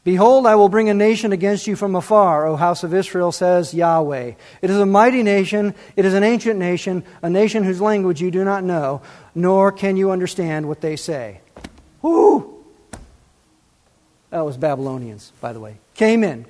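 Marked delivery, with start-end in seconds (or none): 0.0-11.4 preacher booming out Jeremiah's warning; solemn scripture reading. Shown as a demonstration. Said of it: "does this sound familiar". 12.0-12.7 high loud whoo of amazement; preacher's loud high whoo after the verse; excited whoop. Real, not acted. Reaction after reading prophecy.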